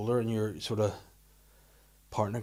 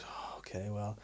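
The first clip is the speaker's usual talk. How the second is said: unvoiced little girl's whisper, very soft; 3 semitones lower, 14 dB quieter, slower than usual. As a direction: hush it like a child sharing a secret. Daughter whispering a swear secret.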